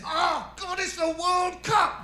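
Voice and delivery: dad shouting. Retold or imitated. Father bellowing at the ringing phone, high shout. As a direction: yell it like a desperate football fan.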